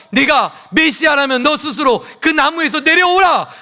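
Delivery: high, loud jeering command; preacher acting out a shouted taunt; mocking demand. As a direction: goad him loudly, like a scoffing crowd. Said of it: "조롱하며 다그칠 것입니다".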